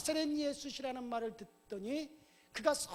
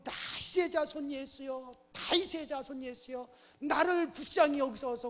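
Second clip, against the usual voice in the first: high, raised-voice plea; crying out; 4 semitones higher, 6 dB louder than usual. Acted. Blind beggar calls to Jesus despite being hushed, crying out. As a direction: keep calling out, stronger on the last plea. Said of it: "소리를 지르니까".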